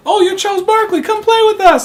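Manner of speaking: put-on begging kids shouting to play; eager kids. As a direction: call out like a pushy little kid. Kids calling to Barkley.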